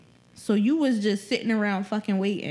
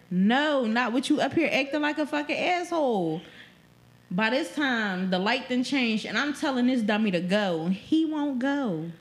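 usual speaking voice

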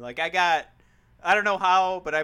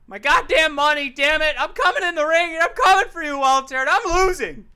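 loud, fired-up wrestling promo; 3 semitones higher, 6 dB louder than usual. Pretend. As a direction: yell it at your opponent. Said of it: "I wanna try and cut a promo".

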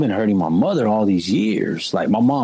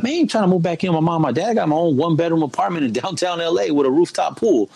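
mock indignant self-quote; 7 semitones higher, faster than usual. Retelling own indignant thoughts.

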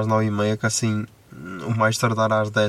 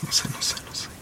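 whispering; 6 dB quieter, much faster than usual. Real, not acted. Repeats words in a whisper.

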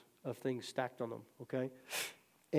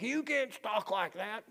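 high, drawn-out mock disapproval; mock scolding; 7 semitones higher, slower than usual. Mimicking religious people rebuking a curser, mock scolding. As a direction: imitate a scandalized churchgoer, slow and high.

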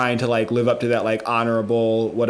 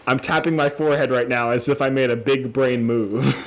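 ordinary delivery

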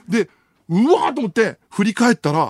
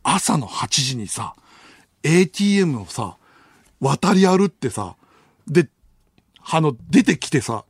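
usual speaking voice

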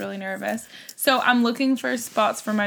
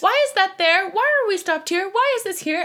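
put-on questioning kid; 9 semitones higher than usual. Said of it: "kid questions".

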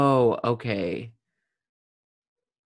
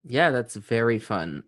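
normal talking voice

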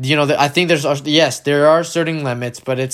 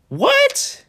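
high squeal of disbelief; retold shriek of shock, pitched way up; incredulous, squeaky outburst; shocked reaction. Retelling own shocked reaction.

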